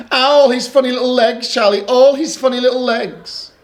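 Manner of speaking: giggly small sister; excited little Lola. Acted. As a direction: squeal it like a delighted little girl. Lola delighted by beetle legs.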